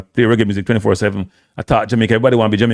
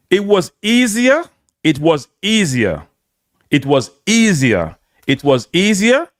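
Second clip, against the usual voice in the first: slow, drawn-out stress on "easier", pitched up; emphatic repetition; 5 semitones higher, slower than usual. Real, not acted. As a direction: hammer "easier" slowly, word by word. Repeats the point for emphasis.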